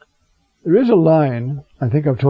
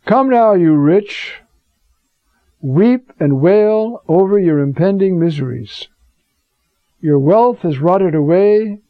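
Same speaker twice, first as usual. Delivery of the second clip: scripture reading; 3 semitones higher than usual. Acted. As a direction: thunder the warning to the rich. Reads James aloud.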